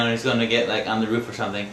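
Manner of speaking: ordinary delivery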